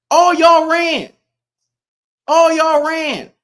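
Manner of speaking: high, loud and drawn out; loud taunt. Real, not acted. Streamer accusing the chat of fleeing homelands, loud taunt. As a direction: stretch each word into a jeering call.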